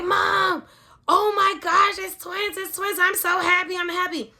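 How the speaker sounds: imitating an overjoyed daughter, high and loud; high-pitched excited gushing; happy screaming. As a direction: squeal it like thrilled news down the phone. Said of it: "My daughter must have been screaming".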